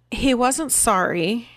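ordinary talk

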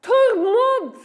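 calling a name loudly, drawn out. Acted. Jesus seeking a believer by name, calling out. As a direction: call out for someone missing. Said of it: "Jesus begynner å si".

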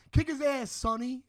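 a hint of a wannabe mafia guy; mobster friend egging on. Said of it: "the guy's friend's like".

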